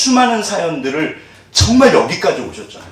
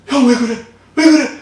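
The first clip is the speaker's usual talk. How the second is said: high, louder, mock-tearful plea; imitating a boy begging while being sold, much higher and louder; desperate pleading; 8 semitones higher, 4 dB louder than usual. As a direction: cry out to the brothers in disbelief. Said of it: "노예로 파는 거예요".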